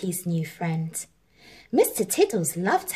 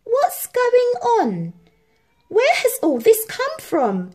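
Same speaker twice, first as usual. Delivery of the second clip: puzzled cry; 13 semitones higher, 4 dB louder than usual. A narrator's reading. Harry finding toys in his room, bewildered cry. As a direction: call out in baffled wonder. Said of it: "he cried".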